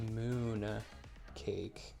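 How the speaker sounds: usual speaking voice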